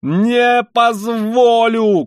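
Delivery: high, loud, long-drawn yell; furious drawn-out cry; shouting. A narrator's reading. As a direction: roar it out, stretching each syllable. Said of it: "крикнул было другой раз Раскольников".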